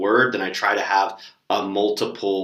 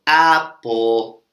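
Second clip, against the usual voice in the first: louder, chanted "Apple" three times; teacher demo; 4 dB louder, much slower than usual. Shown as a demonstration. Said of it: "and I go".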